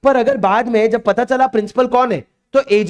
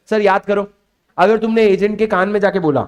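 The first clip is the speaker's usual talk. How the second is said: ordinary talk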